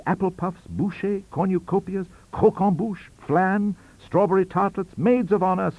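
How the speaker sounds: ordinary delivery, nothing marked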